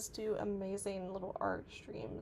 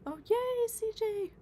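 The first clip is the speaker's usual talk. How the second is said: excited cheer; 13 semitones higher, 8 dB louder, slower than usual. Real, not acted. Cheering a viewer's milestone.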